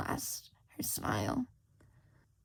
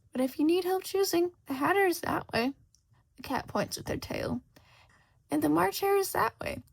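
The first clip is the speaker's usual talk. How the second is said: higher, louder sly cat voice; Cheshire Cat; 5 semitones higher, 3 dB louder than usual. Acted. Cheshire Cat answering lost Shota, sly and amused. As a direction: purr it like a teasing cat.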